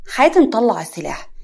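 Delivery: ordinary delivery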